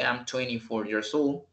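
"twenty-four" run together as twenny-four; common reduced form. Shown as a demonstration. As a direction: skip the t after n in "twenty-four". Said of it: "sino que".

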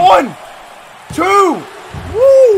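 loud, high count bursting into a whoop; excited pin count. Real, not acted. Commentator counting Billy's pin, excited shouting.

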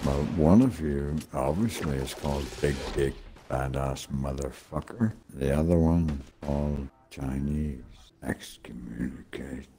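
ordinary talk, nothing marked

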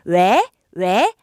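왜 rising like answering a call; second-tone demonstration. Shown as a demonstration. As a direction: ask 왜 as if someone called you, pitch sweeping up. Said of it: "누가 날 불렀다 생각하시고요". (누가 부른 듯 왜를 끝까지 올려서)

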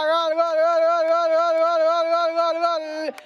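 long high goal scream; breakneck goal commentary. Real, not acted. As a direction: belt out a drawn-out goal call.

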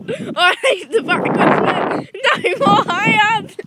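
begging it to stop for real; scared pleading. Real, not acted. Swing going backwards, frightened.

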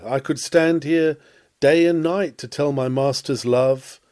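ordinary talk

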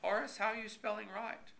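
talking through a mouthful of toast; words round a bite of toast; mouth full. A narrator's reading. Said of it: "said in a muffled voice".

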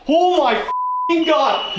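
high, slow, drawn-out yell of real shock. Real, not acted. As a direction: yell it out, stunned by the reply. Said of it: "I'm sorry for yelling".

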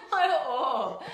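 loud, high, drawn-out through laughter; real laugh breaking through the words. Real, not acted. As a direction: crack up while agreeing. Laughing along at the joke.

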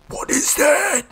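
very high, loud scream; jump-scare screech; terrified shriek. Real, not acted. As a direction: shriek the question in fright. Scared by thing in game.

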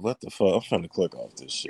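low, deflated viewer quitting the show; bored viewer voice. Retold or imitated. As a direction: mumble it like a bored viewer clicking away. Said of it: "Y'all be like".

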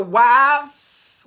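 stretching out WIVES with a long final S; emphasized plural. Shown as a demonstration. Preacher underlining the plural in the verse, WIVES with a long S. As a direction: draw out WIVES, lingering on the hissed S. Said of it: "with S on it".

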